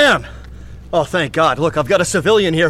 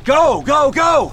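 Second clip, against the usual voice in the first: high urgent yell; shouting go; 10 semitones higher, 5 dB louder, much slower than usual. Acted. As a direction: yell to send them running. Sending the runners across.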